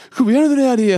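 very high, loud mock panic; hammed-up alarmed squeal; freaking out. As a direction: imitate a panicking swimmer. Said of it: "he's legit freaking out".

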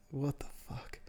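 quiet retold shock; hushed disbelief. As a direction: mouth it to a colleague, barely aloud. Retold silent shock to assistant.

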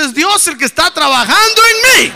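full-force, high-pitched rallying shout. Shown as a demonstration. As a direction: belt it out to rouse the congregation. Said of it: "A ver, más recio". (grito más recio, agudo y a todo pulmón)

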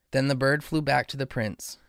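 plain reading voice

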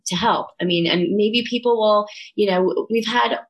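usual speaking voice

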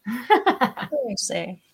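"Caprese" as ka-PRAY-zeh in a playful Italian accent. Shown as a demonstration. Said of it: "if I'm gonna speak with my Italian accent".

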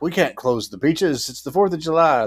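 lightly hammed small-town mayor; mock mayor. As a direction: play the pushy mayor refusing. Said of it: "when the mayor's like".

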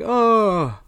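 high bright oh; excited exclaim. Retold or imitated. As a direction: exclaim with a yawny oh. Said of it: "Patrick exclaims".

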